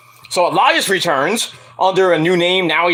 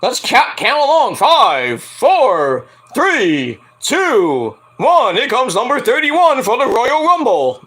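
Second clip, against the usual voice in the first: drawn-out countdown, then high-pitched announcement; mock announcer; 6 semitones higher, slower than usual. Announcer counts down to a Royal Rumble entrant, mock hype. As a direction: count it down like a hyped ring announcer.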